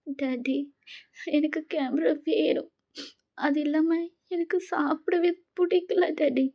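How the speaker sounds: high, slow, tearful pleading; crying. Acted. Daughter crying to her dad that she wants her camera. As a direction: sob it out, barely holding together.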